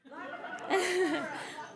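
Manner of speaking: high bright laugh; laughter. Real, not acted. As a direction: laugh out at the joke. Laugh alone on line.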